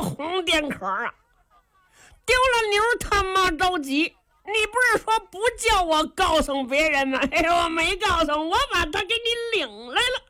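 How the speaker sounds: put-on high, childlike dopey voice of the simple lad; simple-minded boy. Simple lad tells the squire he brought the stranger, high and childish. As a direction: play the naive giant talking like a child.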